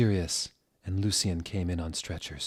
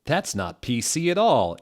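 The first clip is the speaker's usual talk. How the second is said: usual speaking voice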